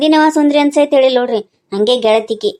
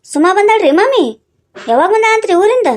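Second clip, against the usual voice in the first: surprised; 7 semitones higher than usual. Acted. Surprised news on phone call.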